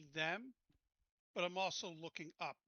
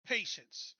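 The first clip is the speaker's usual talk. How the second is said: louder, pressing impatience; frustrated Hargis; 4 semitones higher, 7 dB louder than usual. Acted. Player voicing Hargis pressuring the team, louder and frustrated. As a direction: push him to hurry, clearly irritated. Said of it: "so Hargis will say".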